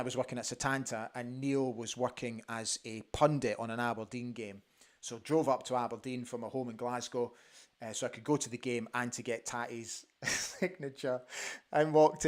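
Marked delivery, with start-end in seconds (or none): none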